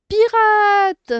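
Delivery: "Pirate" called out high and loud in one go; whole word, exclaimed. Shown as a demonstration. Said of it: "en séparant les syllabes".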